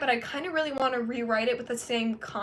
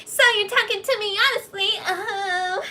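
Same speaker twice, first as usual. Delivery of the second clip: higher, put-on mocking voice; exaggerated mock sincerity; mocking impression; 7 semitones higher than usual. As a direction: parody her earnestly. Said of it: "it's like".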